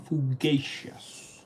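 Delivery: "fugacious" slowly, fu as in furious, ga as in again; careful pronunciation. Shown as a demonstration. Learner assembling the parts, slowly pronouncing "fugacious". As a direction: draw out "fugacious", ending like auspicious. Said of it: "Pronunciation is".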